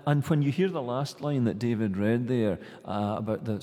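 ordinary delivery

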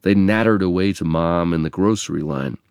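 ordinary delivery

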